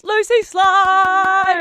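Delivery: loud, high, long drawn-out announcer shout; hyped guest introduction. Real, not acted. Host welcomes her stylist friend on, hyped introduction. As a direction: stretch the name out like a ring announcer.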